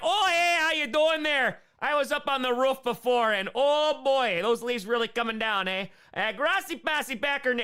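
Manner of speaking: high, loud, hammed-up Upper Midwest folksy voice; Wisconsin accent. Streamer mocking his future self in a thick Wisconsin accent. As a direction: overdo a Wisconsin neighbour chatting over the fence. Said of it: "develop a Wisconsin accent".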